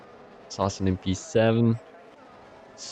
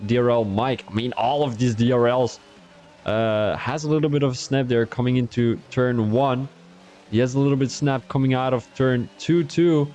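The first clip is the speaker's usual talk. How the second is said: ordinary talk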